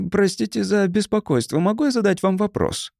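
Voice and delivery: high, polite little voice; thin polite voice. Acted. The thin stranger politely asks the narrator a question. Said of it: "тоненький голос произнёс очень вежливо".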